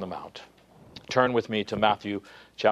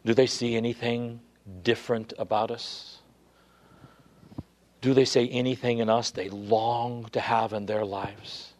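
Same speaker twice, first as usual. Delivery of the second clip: usual speaking voice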